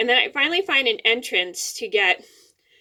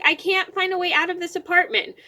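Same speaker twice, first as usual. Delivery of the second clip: retold panic; 8 semitones higher than usual. Retold panicked answer to mom.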